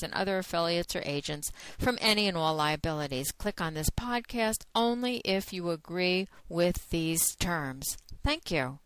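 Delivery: brisk, even legal-warning read; disclaimer read. Acted. Host reading the warning to listeners, matter-of-fact. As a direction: read the terms like a recorded notice. Reads the adult content disclaimer.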